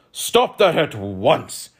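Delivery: loud, slow, commanding shout; thundering. A narrator's reading. King thundering at five brawling guests, slow and loud. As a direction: thunder the order out, drawing it out. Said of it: "thundered Dazaz".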